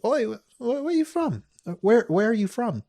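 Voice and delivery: high-pitched mock questioning; excited questioning. Girls asking the newcomer where he's from, high and eager. Imitates girls quizzing his accent.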